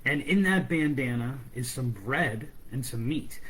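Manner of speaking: plain reading voice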